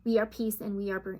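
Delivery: soft guided meditation read. Acted. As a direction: read it like a soothing spiritual meditation.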